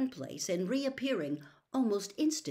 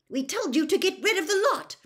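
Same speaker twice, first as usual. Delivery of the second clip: furious scolding; 11 semitones higher, 11 dB louder than usual. Acted. Mother's continued tirade.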